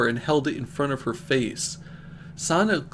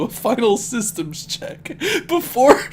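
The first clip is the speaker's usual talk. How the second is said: cracking up; 8 semitones higher, 5 dB louder than usual. Real, not acted. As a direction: voice rising high and louder into laughter. Cracks up mid-sentence.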